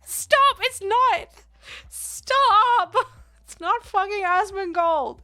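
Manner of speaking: high, loud, laughing pleas to stop; real flustered shouting at chat; laughing protest. Real, not acted. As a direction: beg chat to stop, half laughing, half yelling. Protesting chat's teasing while laughing.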